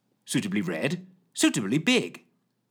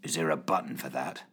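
deep, soft growl; 9 semitones lower, 6 dB quieter than usual. Acted. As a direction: growl it low and doubtful. Fitch gruff.

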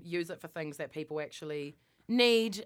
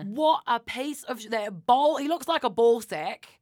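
high, loud indignant rant; worked up; 6 semitones higher, 7 dB louder than usual. Real, not acted. Friend insulting a billionaire on a podcast, worked up. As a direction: spit out insults in rising outrage. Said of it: "I'm getting really worked up".

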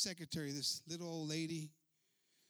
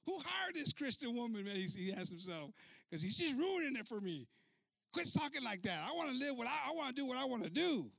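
mock whiny complaint; 6 semitones higher, 5 dB louder than usual. Pastor complaining to himself.

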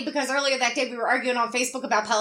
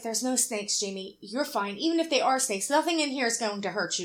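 calm reassuring; 5 semitones lower, 5 dB quieter than usual. Retold or imitated. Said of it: "Fitz is like".

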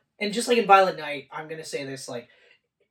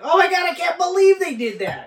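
higher, louder, disbelieving; excited shout; 9 semitones higher, 4 dB louder than usual. Retold or imitated. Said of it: "I was like".

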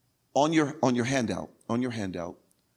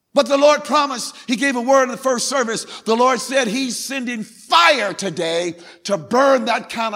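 high, shouted preaching with stretched key words; full-force preaching; 11 semitones higher, 8 dB louder than usual. Real, not acted. Preacher telling scared people that God protects, full-force preaching. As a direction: thunder it to the whole church.

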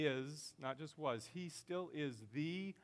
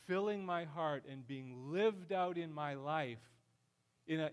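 usual speaking voice

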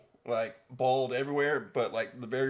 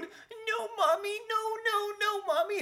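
put-on very high, whiny toddler pleading; imitating pleading toddler; slower than usual. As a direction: beg like a scared little kid. Toddler pleading before a spanking.

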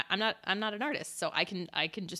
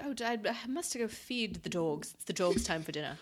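posh Queen voice. Retold or imitated. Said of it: "it's very up here".

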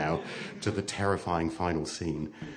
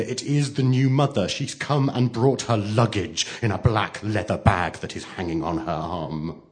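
ordinary delivery

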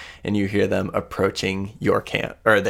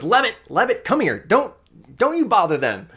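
high-pitched, urgent scolding; calling a pet back; 12 semitones higher, faster than usual. Acted. Woman wrestling her creature calls it back, scolding. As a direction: call the creature off, pitched well up.

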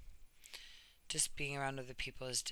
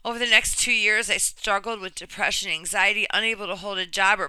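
earnest, measured reading of a personal essay; reading a story aloud; 5 semitones higher, 12 dB louder than usual. Acted. Reading a friend's brave story to viewers, earnest narration. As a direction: read it like you're giving her story a voice.

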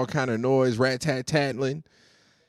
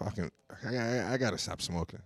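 winded, much softer, gasping through; out of breath; 3 semitones lower, 12 dB quieter, faster than usual. Real, not acted. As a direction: talk like you ran out of air. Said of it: "I'm out of breath".

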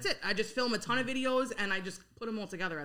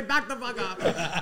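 slow mock yell of a Queens mother; a touch of an angry mother, slowed and laughing; imitating mother; 5 dB louder, much slower than usual. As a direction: drag out a laughing mother's snap. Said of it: "My mother would say".

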